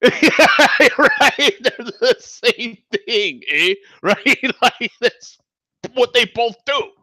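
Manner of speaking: high-pitched laughing talk; cracking up, voice shooting high. Real, not acted. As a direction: squeeze the words out through giggles. Cracking up at own accent bit.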